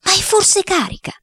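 wide-eyed, high-pitched alarm; high, startled question; alarmed exclamation. A narrator's reading. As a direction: exclaim it in sudden fright. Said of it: "aveva esclamato sgranando tanto d'occhi".

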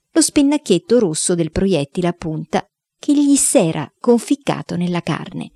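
plain reading voice

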